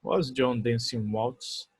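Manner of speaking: very slow question, rising; slow model question. Shown as a demonstration. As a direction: stretch the question for students. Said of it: "se eu quisesse fazer uma pergunta". (pergunta lenta e pausada)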